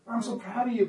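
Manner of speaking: high, soft gushing praise; softly squealed mock praise; gushing cheerleader. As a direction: coo it like an adoring parent. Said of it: "not gonna be a cheerleader".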